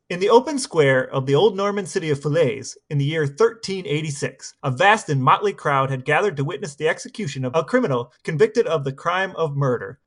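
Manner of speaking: usual speaking voice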